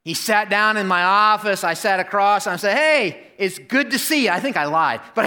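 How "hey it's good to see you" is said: high mock-cheerful welcome, not quite meant; forced cheer. Pastor welcoming the man he thinks hates him, forced cheer. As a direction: put on a bright greeting you don't feel. Nervous welcome he admits lying.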